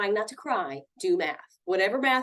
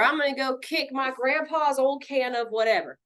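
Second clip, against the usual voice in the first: higher, put-on indignant rant; mock angry threat; 4 semitones higher than usual. Counselor mimics threatening grandpa's things, mock angry threat. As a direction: rattle off a pretend tantrum threat.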